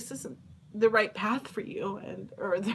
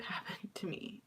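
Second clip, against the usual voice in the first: ordinary talk, nothing marked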